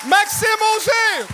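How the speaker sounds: announcer call. Acted. Host introduces next comedian.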